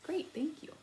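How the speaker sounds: whispered great, thank you; soft thanks. Real, not acted. Teacher quietly thanking the class, whispered. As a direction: hush it softly after the pause.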